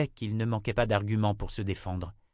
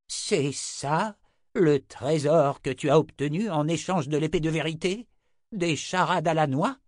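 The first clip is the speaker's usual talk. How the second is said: scornful; 7 semitones higher, 4 dB louder than usual. Acted. Zed scornful.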